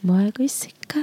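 unvoiced sultry whisper. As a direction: breathe it softly into the phone. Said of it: "전화 목소리로 두 분 음성 변조".

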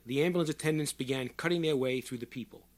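ordinary delivery, nothing marked

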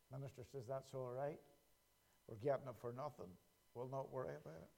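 dropped far down, soft and quick; quiet. Acted. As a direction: tell it under your breath. Quiet aside in joke.

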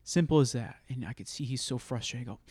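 ordinary delivery

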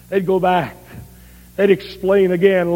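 normal talking voice

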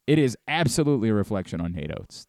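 ordinary talk

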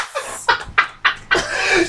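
airy wheezing laugh; wheezing at a friend's joke; breathless silent laugh. Real, not acted. Reacts to the teasing.

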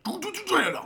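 high chirpy nonsense babble; droid babble imitation. Mimicking the Jabba door droid for a co-host. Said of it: "He's like".

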